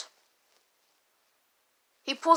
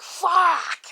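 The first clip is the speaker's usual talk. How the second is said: higher drawn-out swear; mock exasperated curse; 5 semitones higher than usual. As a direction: let out a frustrated mock cry. Cat curses at being called.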